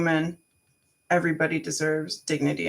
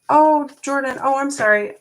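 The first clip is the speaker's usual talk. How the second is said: surprised apology; 8 semitones higher, 7 dB louder than usual. Real, not acted. Missed a viewer's question.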